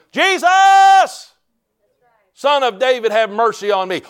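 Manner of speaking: high, loud cry for mercy; shouted plea. Retold or imitated. Blind Bartimaeus calling to Jesus, desperate shout. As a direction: holler the name, stretched out high. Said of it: "He shouted".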